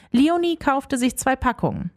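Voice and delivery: plain reading voice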